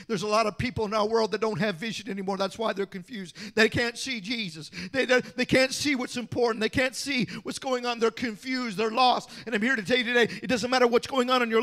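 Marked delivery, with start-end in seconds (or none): none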